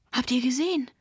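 called out. A narrator's reading. Tamara finds a note on the drum, calling out. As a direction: call the others over to look. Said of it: "rief sie".

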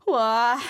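loud disgusted uah; disgusted groan. Acted. Narrator imagining the stench, loud disgusted groan. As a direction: groan at the thought of the smell.

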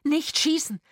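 high, loud plea to hold fire; alarmed shouted plea. A narrator's reading. Trapped actor begging them not to shoot, alarmed shout. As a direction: cry out urgently.

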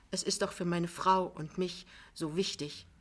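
ordinary delivery, nothing marked

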